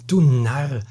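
loud, amused jeer; laughing. A narrator's reading. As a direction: throw it out with a mocking laugh. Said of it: "lachte der Hauptmann".

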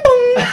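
high-pitched bing-bong noise; imitated call chime. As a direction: mimic a cabin chime way up high.

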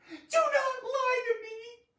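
slow, high, loud wailing scream. Acted. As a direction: scream it out, drawing the words long. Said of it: "She looked at me and screamed".

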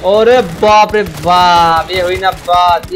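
drawn-out, loud whoop of amazement; loud, excited cheer. Real, not acted. Streamer cheers on the game battle, loud excited cheer. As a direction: stretch it out loud and thrilled.

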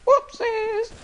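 very high, loud cartoonish whoopsies; silly squeal. Spy player goofing around, high cartoon squeal. Silly exclamation during play.

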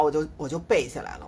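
ordinary talk

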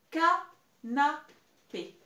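"Canapé" very slow, gaps between ca, na, pé; syllable by syllable. Shown as a demonstration. Teacher repeating the dictated word, slow separated syllables. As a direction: pause after each syllable of "Canapé", loud. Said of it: "Il y a trois syllabes".

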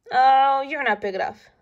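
loud dismissive scoff; grouchy ladybug. A narrator's reading. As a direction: scoff and fly off. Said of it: "said the ladybug".

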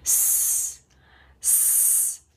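स as a soft voiceless hiss, no vowel; just hiss स out. Shown as a demonstration. Teacher modelling the s sound, स as a soft hiss. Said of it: "हम इसकी सिर्फ sound निकालेंगे".

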